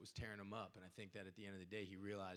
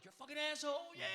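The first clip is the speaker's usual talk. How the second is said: high, loud insult yelled at him; heckling fan; 9 dB louder, faster than usual. Retold or imitated. Fans heckling the actor everywhere he went, loud insult. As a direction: bellow it like a stranger on the street. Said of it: "people would be like".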